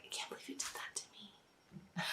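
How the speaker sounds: hurt little whisper; mock-wounded whisper. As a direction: breathe it out like a wounded child. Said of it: "it's not like".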